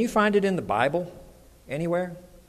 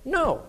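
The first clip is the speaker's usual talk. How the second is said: high, sharply falling "no"; a short, decisive "no"; emphatic no; 10 semitones higher than usual. Acted. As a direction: pitch "no" up high and drop it firmly. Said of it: "I tell you emphatically".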